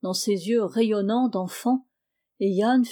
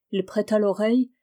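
plain reading voice

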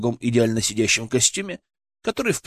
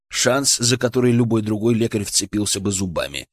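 ordinary delivery, nothing marked

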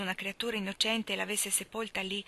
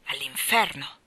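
low, loud, bitter retort; bitter outburst; 6 semitones lower, 5 dB louder, slower than usual. Acted. Lori to Joe storming off, a low loud bitter cry. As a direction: fling it out in despair.

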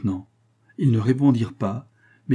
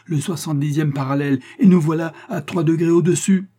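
rising, high-pitched reproach; indignant outcry; 7 semitones higher, 5 dB louder than usual. A narrator's reading. Astronomer blames the lieutenant for the wrong latitude, indignant outcry. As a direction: build to a loud, exasperated finish.

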